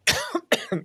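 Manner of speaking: harsh choking cough; coughing fit. Real, not acted. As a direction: cough hard after inhaling a fly. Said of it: "I just inhaled a fly".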